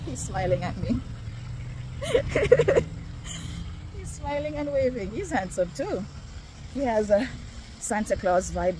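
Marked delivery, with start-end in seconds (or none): 1.0-3.9 high breathy giggle; a shy drawn-out giggle. Real, not acted. Giggles about man smiling.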